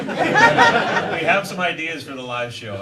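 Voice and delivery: real amused laugh breaking into the words. Real, not acted. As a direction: giggle your way through the line, drawn out. Laughing while announcing live show.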